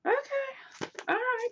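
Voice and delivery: high squeaked okay, all right; squeaky okay. Real, not acted. Vlogger reacting to the author's photo, squeaky and high. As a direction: squeak it out in delight.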